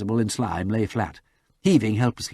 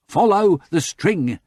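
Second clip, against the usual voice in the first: loud, slow yelled instruction; 8 semitones higher, 6 dB louder, slower than usual. A narrator's reading. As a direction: bellow the direction slowly. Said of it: "yelled Bunter".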